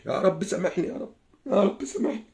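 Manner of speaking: hushed plea for forgiveness; spent, very soft supplication; remorseful prayer. Real, not acted. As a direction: drop to a soft, private prayer. Asks forgiveness after cursing.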